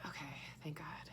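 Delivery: breathy whisper of relief; hushed, relieved whisper. Real, not acted. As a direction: whisper it like a secret thank God. Relieved aside about the book.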